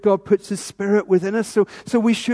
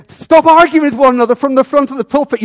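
louder and higher, calling out from the pulpit; mock stern rebuke; 7 semitones higher, 8 dB louder than usual. Preacher pretends to rebuke Colin and Alan from the pulpit, loud. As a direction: scold two members loudly by name. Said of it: "if I was to say to Colin".

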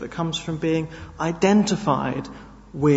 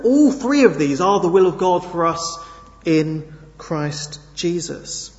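usual speaking voice

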